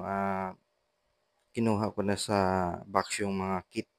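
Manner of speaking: usual speaking voice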